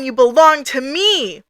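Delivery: slow, possessive mock-angry claim; hammed-up fury, stretched out and swooping on the last word; enraged god. Angry god claims Ray as its own, acted fury. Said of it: "Our god is mad. It's angry. It's pissed".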